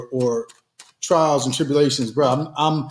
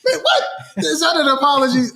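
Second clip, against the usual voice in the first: much higher, incredulous yelp; mock disbelief; 8 semitones higher than usual. Husband on podcast mocking his own apology, higher disbelief. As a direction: act stunned at the lame apology.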